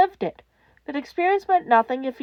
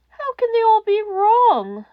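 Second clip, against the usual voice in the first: cracking voice; 11 semitones higher, 7 dB louder, slower than usual. A narrator's reading. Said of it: "the voice whispered, cracking".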